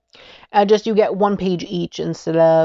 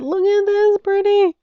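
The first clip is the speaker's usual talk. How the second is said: delighted squeal; 13 semitones higher than usual. Real, not acted. Gushing over tarot card art.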